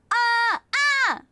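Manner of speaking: two short, high, loud screams; repeated test yelps, "Ah, ah!"; demo scream. Shown as a demonstration. Streamer trying to make the meter jump, short screams. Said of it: "that still wasn't that much".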